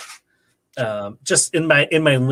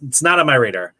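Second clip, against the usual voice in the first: ordinary delivery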